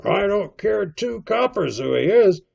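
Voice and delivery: loud, high indignant shout; crying out. A narrator's reading. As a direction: bellow it across the tavern. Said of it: "cried Silver".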